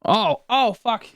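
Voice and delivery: sudden pained cry from a leg cramp; getting a leg cramp. Real, not acted. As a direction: yelp through a cramp. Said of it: "My leg cramped up".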